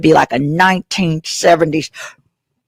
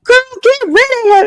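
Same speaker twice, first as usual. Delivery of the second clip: put-on much higher sassy girlfriend voice; sassy friend voice. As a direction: camp it up high and sassy.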